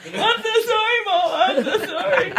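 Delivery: high, loud wailing imitation; mock sobbing. Mom saying sorry to her own mother, mock sobbing. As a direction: ham up a sobbing apology. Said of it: "I mean, just sobbing".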